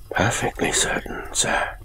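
hushed, cool butler with a touch of surprise; cold, faintly resentful. A narrator's reading. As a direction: answer softly, slightly affronted. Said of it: "cold voice of the butler sounded surprised, almost resentful".

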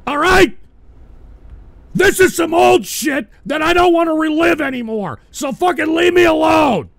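loud, furious pleading to make it stop; real angry shouting at the chat; angry yelling. Real, not acted. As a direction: shout them down, fed up and upset.

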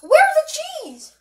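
high-pitched, drawn-out panicked question; frantic demand. Acted. Cook demanding the missing cheese from bro, high and frantic.

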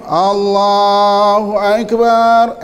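very slow, drawn-out Allahu Akbar; mock imam intoning the takbir; imitating Mecca imam. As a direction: stretch it out like the imam leading prayer. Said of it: "niru, niru Imam Mekah lah tu".

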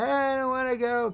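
high, mock childish whine; whining boy. Boy refuses to leave with his parents, imitated whining. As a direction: imitate a kid complaining in a high voice. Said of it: "he's whining".

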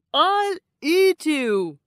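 loud, slow monster shout; monster's shout. A narrator's reading. Monster threatens the little goat, loud slow shout. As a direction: threaten him at full volume, slowly. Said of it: "shouted the monster".

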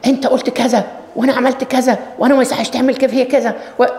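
high rapid irritated accusation; sharp angry rant. Retold or imitated. A man rebukes you sharply, high angry rant. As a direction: snap out the complaint in a rush. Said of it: "بحدة".

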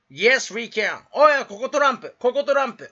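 mock slogan. Streamer jokes about presidents, loud mock proclamation. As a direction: proclaim it, then heckle yourself.

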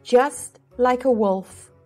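plain reading voice

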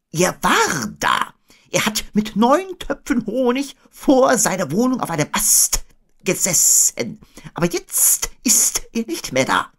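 high owl voice, stretched in places; Eule. A narrator's reading. Owl reporting where Pooh sat, high-voiced. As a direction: report high up, drawing out word endings.

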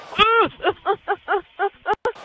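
high staccato laugh; rapid high giggling burst. Real, not acted. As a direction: laugh in a long high chain. Reacting during play.

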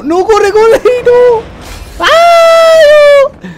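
2 very high, loud shrieking panic; 3 shriek as the danger closes in; 1 panicked shriek. Real, not acted. 4 gamer panicking as his partner runs off, high shriek. Partner running off, enemy near.

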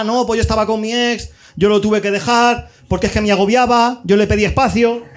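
normal talking voice

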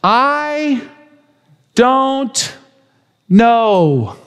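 each word held apart with a pause; drawn-out I don't know. Acted. Preacher voicing the healed blind man's humble words. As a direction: slowly lay out I, don't, know. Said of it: "what he says over and over".

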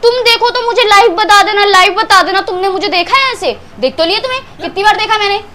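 high-pitched, indignant tirade; angry accusation. Real, not acted. Woman accusing partner over what she saw, indignant.

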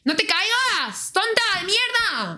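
high, exasperated yell; yelling at the screen. Real, not acted. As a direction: shout at the clumsy character. Yelling at game character.

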